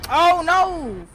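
high loud panicked cry; alarmed. Real, not acted. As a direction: blurt it out in sudden alarm. Caught by killer in game.

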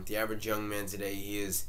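normal talking voice

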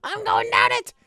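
usual speaking voice

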